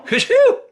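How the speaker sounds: loud high sneeze, sudden and sharp; big sneeze. A narrator's reading. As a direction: let out a huge cartoon sneeze. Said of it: "Peter sneezed".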